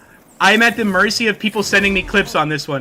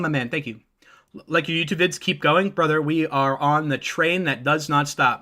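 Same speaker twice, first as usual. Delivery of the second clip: usual speaking voice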